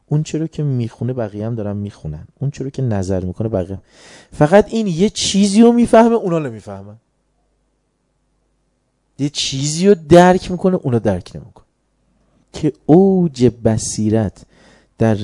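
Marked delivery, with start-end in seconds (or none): 4.3-6.1 higher and louder, stress on رو; preaching emphasis. Real, not acted. 9.2-10.8 higher and louder, stress on رو; preaching emphasis. Real, not acted.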